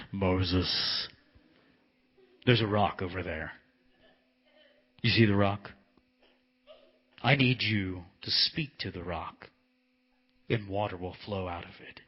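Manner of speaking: deep, booming God voice. Acted. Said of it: "let me do my God voice".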